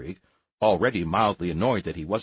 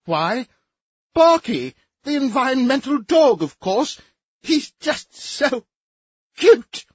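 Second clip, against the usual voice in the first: very high, gushing and loud; high chirpy gush; 7 dB louder, slower than usual. Acted. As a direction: chirp it way up, swooning.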